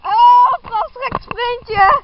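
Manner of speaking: loud, slow sprint shout; shouting while sprinting. Real, not acted. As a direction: yell it out mid-sprint. Sprinting during the run.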